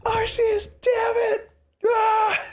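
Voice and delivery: very high, squealed agony; Ash. Acted. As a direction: swear through hammed-up pain. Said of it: "they just see Ash".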